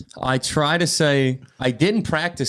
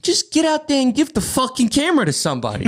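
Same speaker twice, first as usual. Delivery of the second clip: bossy guy; 12 semitones higher, 3 dB louder than usual. Retold or imitated. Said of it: "He goes".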